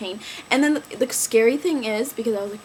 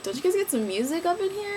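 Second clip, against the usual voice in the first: higher teasing request; high playful ask; 8 semitones higher than usual. Retold or imitated. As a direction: tease the paramedics up high. Joking with paramedics.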